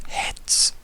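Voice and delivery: breathy whispered question to a companion. Acted. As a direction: whisper it aside, puzzled. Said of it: "he quickly turns to the and goes".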